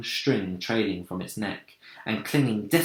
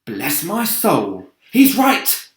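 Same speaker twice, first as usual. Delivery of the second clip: exclaiming; 5 semitones higher, 6 dB louder, slower than usual. A narrator's reading. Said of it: "the old green grasshopper exclaimed".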